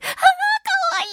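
very high, drawn-out fangirl squeal; stretched high coo of delight; gushing squeal. Retold or imitated. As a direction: squeal in hammed-up adoration. Gushing over a cute actress.

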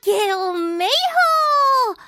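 high, loud, slow stretched-out cheer. Acted. Host cheering the new year to his radio wives, high, loud and slow. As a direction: stretch the greeting into a long happy cry.

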